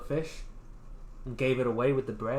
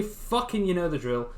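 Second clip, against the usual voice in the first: ordinary delivery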